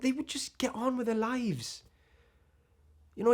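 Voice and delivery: ordinary delivery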